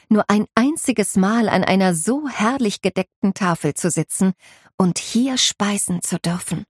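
ordinary delivery

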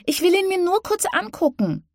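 put-on very high sulky little-girl voice; pouting child. A narrator's reading. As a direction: pout through the words. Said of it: "Winnie schmollte".

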